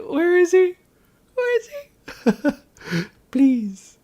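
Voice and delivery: very high, squeaky mock plea; hammed-up high-pitched searching call; imitating waiting crowd. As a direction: mimic them in a tiny high voice. Voices everyone waiting for Daniel.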